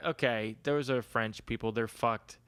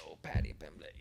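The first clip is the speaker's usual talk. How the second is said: bad Irish accent; 8 semitones lower, 7 dB quieter than usual. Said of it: "I don't know how to do the Irish accent".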